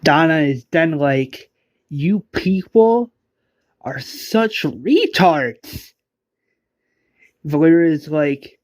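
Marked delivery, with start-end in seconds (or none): 1.9-5.9 high, slow contemptuous sneer; slow, mocking villain jeer. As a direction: drag out the insult with scorn.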